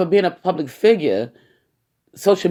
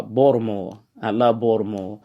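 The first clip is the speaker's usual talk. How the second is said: local Baltimore accent, "Baltimore" run together as "Bawd-a-more"; 4 semitones lower than usual. Shown as a demonstration. Podcaster corrects himself for y'all, in Baltimore accent. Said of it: "the Baltimore accent".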